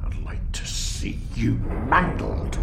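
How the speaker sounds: slow menacing whisper; whispered threat. Acted. Threatening the architect.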